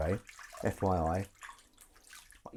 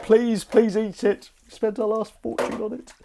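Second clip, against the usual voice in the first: high, slow mock begging; pleading; 10 semitones higher, 4 dB louder than usual. Owners begging the fussy kitten to eat, mock-desperate plea. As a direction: implore the cat, hammed-up. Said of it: "We're like".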